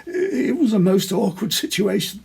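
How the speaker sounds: high, strained voice unlike his own; voice not his own. A narrator's reading. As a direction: squeeze it out, hardly himself. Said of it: "in a voice that hardly seemed his own".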